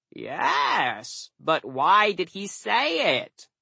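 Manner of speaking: high, drawn-out crow voice; querulous crow. Acted. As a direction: press the question as a puzzled crow.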